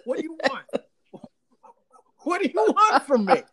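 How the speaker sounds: high, loud challenge through laughter; playacted outburst, breaking into laughs; mock exasperation. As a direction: throw up an exasperated, hammed-up demand. Acting out Cuomo's exasperated challenge.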